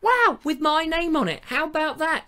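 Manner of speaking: hammed-up happy amazement; touched delight. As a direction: gush like a delighted child. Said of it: "I thought".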